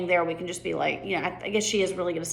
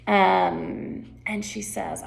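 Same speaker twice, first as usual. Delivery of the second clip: ordinary delivery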